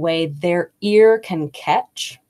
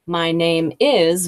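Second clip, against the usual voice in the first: very slow; slower than usual. Retold or imitated. Teacher imitating students' slow intro, drawn out. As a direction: drag out My name is, very slowly. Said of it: "very slow".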